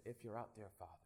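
near-whisper cry for help; hushed prayer. Retold or imitated. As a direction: say it faintly, as if afraid no one hears. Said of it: "I said".

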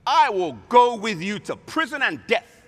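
loud, high-pitched declaration; ringing pledge of loyalty; bold vow. Acted. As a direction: proclaim it as a fervent pledge. Peter's vow acted out.

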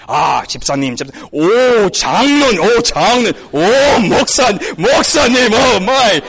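mock-awed church member; fawning reverence. Acts fawning greetings to ranked titles.

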